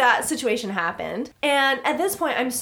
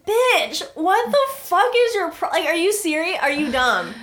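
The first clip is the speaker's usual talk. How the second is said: high, loud, incredulous snap; mock outrage, voice jumping up; retelling her furious text as a shout; exasperated retort; 7 semitones higher, 3 dB louder than usual.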